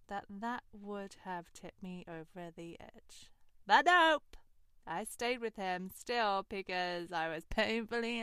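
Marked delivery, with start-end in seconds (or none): 3.6-4.4 emphatic. Real, not acted. Emphatic punchline.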